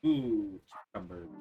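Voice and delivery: ordinary talk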